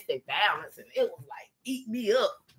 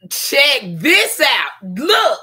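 higher and louder, stretched out slowly; excited show-off; 7 semitones higher, 10 dB louder, much slower than usual. Real, not acted. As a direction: call them over to look, thrilled. Showing off new Bible.

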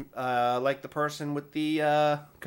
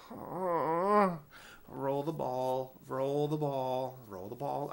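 very slow, softer murmured chant; slow calming mantra; 5 dB quieter, much slower than usual. Retold or imitated. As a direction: drag each word to keep calm. Self-calming with stress ball.

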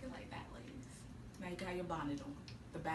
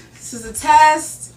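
loud announcing; 6 semitones higher, 14 dB louder, slower than usual. Real, not acted. Second mic check.

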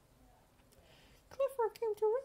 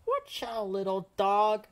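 loud warning shout; painter's yell; 13 semitones lower, 6 dB louder than usual. Acted. Painter shouting a warning at the little dog, loud. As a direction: call out to stop the little dog. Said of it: "The painter yelled".

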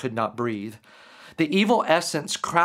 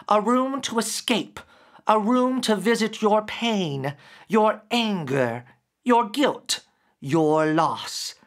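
higher, slow, otherworldly voice; gentle grief creature; 6 semitones higher than usual. Acted. Creature gently making Derek remember, higher, slow. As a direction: speak as a patient spirit revealing itself.

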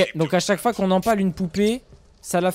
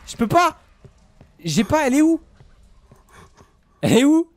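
being chased; 7 semitones higher, 6 dB louder than usual. Real, not acted. Being chased, searching frantically.

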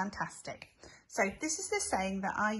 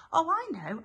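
mock realisation; 7 semitones higher, 5 dB louder than usual. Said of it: "pretend sudden recall".

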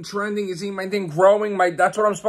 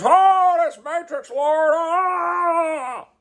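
high, whiny cartoon puppet voice, much higher; mock Muppet voice; 10 semitones higher, much slower than usual. Mocking a commenter who knocks his videos, in a high Muppet voice. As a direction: squeal it like a silly Muppet mocking a critic. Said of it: "Sounds like friggin' Muppets".

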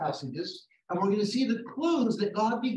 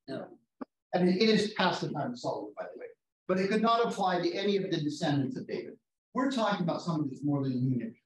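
ordinary talk

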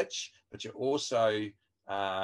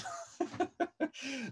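high laugh; 6 dB quieter than usual. Real, not acted. Laughing at own joke.

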